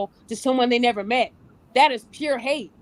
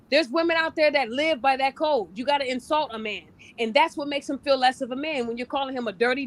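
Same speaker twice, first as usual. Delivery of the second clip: ordinary talk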